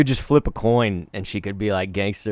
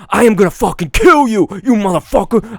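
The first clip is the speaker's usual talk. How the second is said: louder, higher hammed-up menace; mock threat; 8 semitones higher, 7 dB louder than usual. Imagined mugger threatening C K with stabbing. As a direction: play a thug threatening a stranger. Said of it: "Someone could be like".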